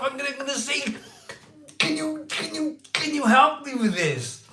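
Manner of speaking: high, loud, breathless excitement; fanboy squealing. Real, not acted. Vlogger squealing about meeting Simon Wood. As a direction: squeal like a starstruck fan.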